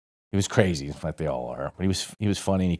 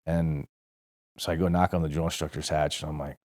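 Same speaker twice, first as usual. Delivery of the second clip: ordinary talk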